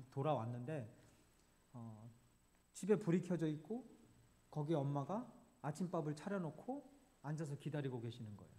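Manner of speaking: normal talking voice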